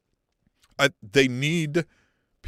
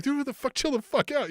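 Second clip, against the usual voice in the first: mock incredulous high voice; 12 semitones higher than usual. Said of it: "he's like".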